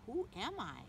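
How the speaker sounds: usual speaking voice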